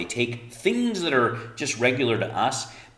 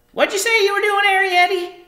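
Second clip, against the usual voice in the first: much higher, louder mother's call from another room; 14 dB louder than usual. Acted. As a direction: call out like a busy mum in the kitchen. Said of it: "called Homily from the kitchen".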